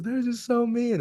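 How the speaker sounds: very high, loud retold wail; mock bawling. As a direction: imitate a drunk man crying out pitifully. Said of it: "he just starts bawling".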